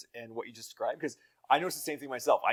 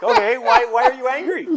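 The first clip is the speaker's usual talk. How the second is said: much higher and louder, mock-alarmed; a dad's exaggerated startled "why?"; surprised parent; 15 dB louder than usual. Dad asking his angry daughter why, high and loud. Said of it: "and you're like".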